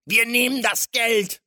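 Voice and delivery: loud, high-pitched robber's threat; thundering villain. A narrator's reading. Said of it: "donnerte sie der Stämmige an". (donnernde Schurkenstimme)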